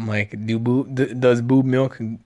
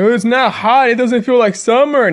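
mocking own complaint; 8 semitones higher, 6 dB louder than usual. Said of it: "I was like".

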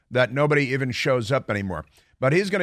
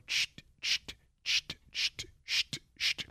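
unvoiced sht sht like a broom sweeping; broom sweeping noise; 10 dB quieter, slower than usual. Showing how to sweep New York, imitated broom swish. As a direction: hiss out the sound of a broom brushing the floor.

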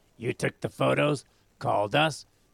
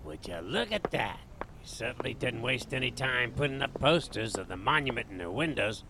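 usual speaking voice

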